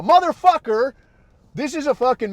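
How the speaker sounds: high, loud exasperated curse; exasperated outburst. Real, not acted. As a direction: blurt it out in disbelief.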